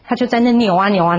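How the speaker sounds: ordinary talk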